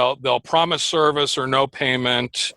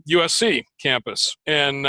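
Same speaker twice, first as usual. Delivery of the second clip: ordinary delivery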